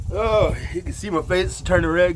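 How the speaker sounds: strained by the heat. Real, not acted. Face red from heat.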